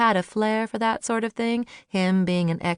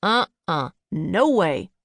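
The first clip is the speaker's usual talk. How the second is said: emphatic refusal; 2 semitones lower, 3 dB louder, slower than usual. A narrator's reading. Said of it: "shook his head emphatically".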